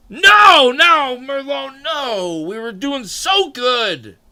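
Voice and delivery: very high, loud "no"s fading into a slow lament; whining high protest that slowly sinks; wailing mock protest. Real, not acted. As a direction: cry out in mock despair at a friend's betrayal. Protesting a viewer's choice.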